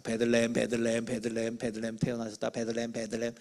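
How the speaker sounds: low monotone chant, word after word; teacher hammering a lesson in, low and level; droning repetition. Acted. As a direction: drone it on like an endless drill. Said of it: "삼십 분 내내 떠들었어요".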